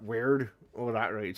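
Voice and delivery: slow, rolled R's; Gloucester accent. Shown as a demonstration. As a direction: stretch every R out. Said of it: "then you start doing the R's".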